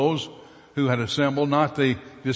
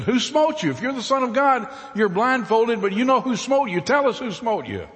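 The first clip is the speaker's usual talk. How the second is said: high, loud taunting jeer; a mob jeering at a blindfolded Jesus; mocking; 12 semitones higher, 5 dB louder than usual. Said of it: "The mob mocked Jesus".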